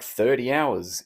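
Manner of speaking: ordinary talk, nothing marked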